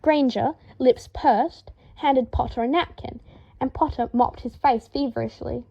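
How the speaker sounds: plain reading voice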